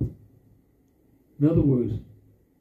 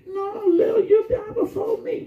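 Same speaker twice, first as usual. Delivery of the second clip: risen dead man. Pretend. Risen dead man argues with Lil in a very high crying shout. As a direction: squeal it out like a comic corpse bickering.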